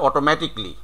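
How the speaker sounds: "অটোমেটিক্যালি" shortened to oto-metik-li; "অটোমেটিক্যালি" ending in "tik-li"; correct "অটোমেটিক্যালি". Shown as a demonstration. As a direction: say "অটোমেটিক্যালি" without the "kaa". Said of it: "এই উচ্চারণগুলো কিন্তু আমরা পাবো".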